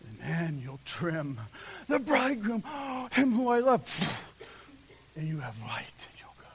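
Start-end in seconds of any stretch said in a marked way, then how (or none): 1.9-3.8 high, louder, with a gasp of longing; acted breathless delight. Preacher playing the bride awaiting her bridegroom, gasping delight. 5.1-6.5 low whisper fading away to almost nothing; hushed whisper. Acted. Preacher telling of lamps lit for the bridegroom, hushed whisper.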